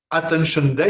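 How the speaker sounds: Attention Day said the English way, atten-shun day; English accent. Shown as a demonstration. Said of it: "il faut le dire avec l'accent, anglais".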